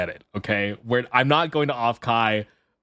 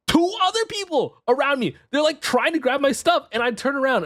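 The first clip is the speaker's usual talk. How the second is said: usual speaking voice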